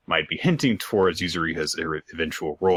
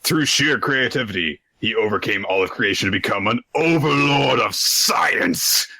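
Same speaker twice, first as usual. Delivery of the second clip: raised, dramatic manga narrator; mock-grand narration; 4 semitones higher than usual. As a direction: bombastic narrator, swelling on the final title. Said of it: "we get this narration".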